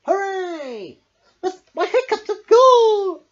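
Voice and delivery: high, loud overjoyed cheer; joyful cheer. A narrator's reading. Smiley Shark celebrating the end of hiccups, joyful. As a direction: cheer with laughing relief.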